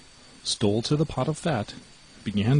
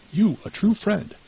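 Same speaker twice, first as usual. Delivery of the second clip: bitter accusation; 11 semitones higher, 4 dB louder than usual. Acted.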